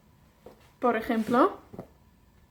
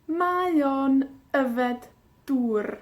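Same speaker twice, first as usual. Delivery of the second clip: slow, deliberate Welsh model; slow model phrase; much slower than usual. Shown as a demonstration. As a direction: draw out Mae o'n yfed dŵr for learners.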